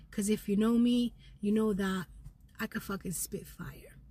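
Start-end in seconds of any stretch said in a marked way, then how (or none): 2.6-4.0 a sudden hushed whisper; whispered aside. Real, not acted. Confiding to viewers in a hushed whisper. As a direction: drop to a whisper for the boast.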